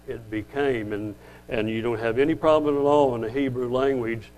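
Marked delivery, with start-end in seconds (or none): none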